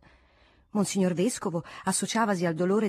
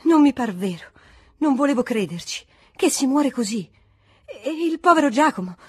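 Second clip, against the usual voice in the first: louder, rising, grieving aunt's outpouring; tearful lament; 4 semitones higher, 5 dB louder than usual. Acted. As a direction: pour out the grief in a raised, emotional voice. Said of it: "la più commossa era donna Graziella". (lamento commosso a voce alta)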